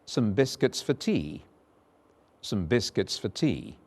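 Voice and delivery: "biscuits" with short i, "tea" with long ee; model sentence. Shown as a demonstration. Teacher modelling short and long vowels. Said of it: "Look at the second sentence".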